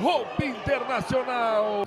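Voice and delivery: high, slow drawn-out name shout; stretched name call. Real, not acted. Naming the scorer after goal. (nome do artilheiro prolongado)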